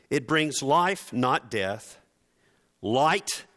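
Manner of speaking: normal talking voice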